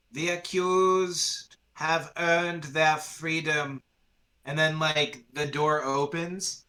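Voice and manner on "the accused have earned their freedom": slow, drawn-out proclamation; voice from above. Acted. Voice from above frees the accused, slow proclamation. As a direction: pronounce the verdict with stretched words. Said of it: "then you hear from above".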